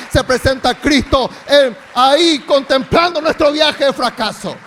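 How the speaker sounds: loud fervent preaching over music; full-force preaching. Real, not acted. Pastor preaching loudly over worship music. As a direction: keep the fire up, loud.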